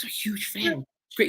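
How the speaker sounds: high, very soft fan voice; small, starstruck boyish voice; little-boy awe. Retold or imitated. Doorman confesses he is a fan, little-boy awe. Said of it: "He turned into a little boy".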